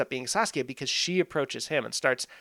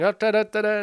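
raised, higher chanting yell; imitated yelling; 8 semitones higher, 5 dB louder than usual. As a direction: mimic a family chanting loudly at dinner. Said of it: "and they're yelling".

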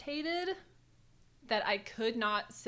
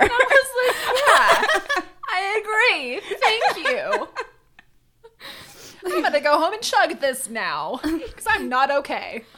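giggling, much higher and louder; laughing reply; 10 semitones higher, 14 dB louder than usual. Retold or imitated. Friend laughing while acting out thanking the British lady, giggling. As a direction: laugh your way through the thanks.

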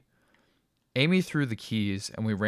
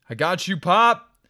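strained rescue; 10 semitones higher, 10 dB louder, slower than usual. A narrator's reading. Rescuing father from fire.

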